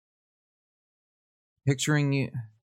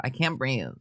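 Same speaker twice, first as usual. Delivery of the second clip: mocking; 4 dB quieter than usual. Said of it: "I hate when people say that".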